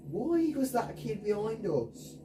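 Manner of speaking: ordinary talk, nothing marked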